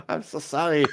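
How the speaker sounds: mock French apology; French accent. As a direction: say sorry in put-on French. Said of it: "he is French".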